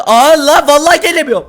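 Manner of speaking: breathless mock panic attack; hyperventilating. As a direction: panicky gasping, high and loud. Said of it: "Panik atak". (hiperventilasyon, panik nefes)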